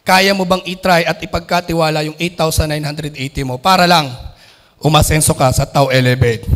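normal talking voice

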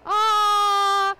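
loud excited ah; delighted shout. Real, not acted. Host reacting to a viewer's comment, delighted loud ah.

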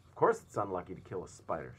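loud emphatic shout; loud certain shout. A narrator's reading. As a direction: shout it at them. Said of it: "shouted the centipede".

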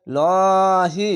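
"লহি" very slow, ল held long and thick; one-alif stretch. Shown as a demonstration. Teacher models the word for learners, slowly stretched. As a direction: recite "লহি", drawing out a heavy ল. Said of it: "খার যাবার এক আলিফ টেনে পড়বেন".

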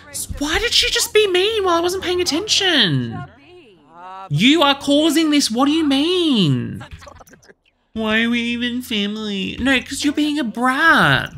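high, loud exasperated scolding; frustrated yelling. Real, not acted. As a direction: yell at the bratty sims. Fighting sims.

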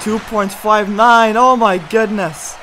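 ordinary talk, nothing marked

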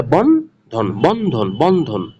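বন্ধন parted into two beats, বন and ধন; teacher sounding out বন্ধন in two pieces; syllable by syllable. Shown as a demonstration. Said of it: "শব্দে দুইটা অক্ষর".

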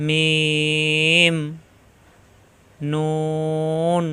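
লাম and মিম held very long and slow; drawn-out letter names. Shown as a demonstration. Teacher drawing out letter names for students to copy. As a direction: stretch each letter name out slowly. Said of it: "মিলানো ঠোঁট ছেড়ে দিয়ে".

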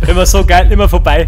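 loud exasperated outburst; loud complaint. Real, not acted. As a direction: vent loudly. Missing with the hammer.

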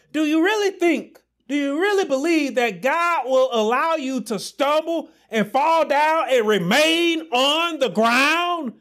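preaching at full force. Acted. Preacher shouting hope to his congregation, fervent.

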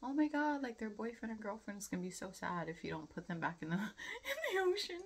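high, rushed, hammed-up fretting, ending in a laugh; mock-tragic plea, half laughing; pleading for the fish. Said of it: "but I was like".

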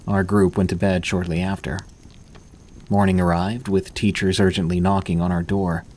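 usual speaking voice

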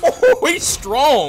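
loud, high, slow wail; amazed exclamation. Real, not acted. Gamer facing a strong enemy, amazed. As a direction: drag it out loudly in awe.

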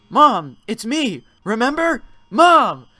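son yelling to be recognised; desperate shouting. Acted. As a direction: call out to her, desperate. Son shouts at his mother.

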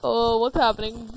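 usual speaking voice

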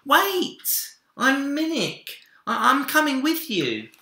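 put-on high, loud child voice calling after him; small boy calling out. A narrator's reading. Minik calls out to Big Bear, high and loud.

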